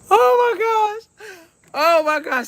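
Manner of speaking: high, drawn-out squeal of laughter, then settling; real helpless laughter. Real, not acted. Mother tickled by her son's remark, helplessly laughing. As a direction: gasp it out, overcome with laughing. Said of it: "I couldn't do nothing but laugh".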